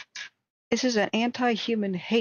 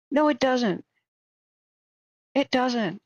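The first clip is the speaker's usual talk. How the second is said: angry; 5 semitones higher, 5 dB louder, slower than usual. Real, not acted. Rebutting quoted claim, angry.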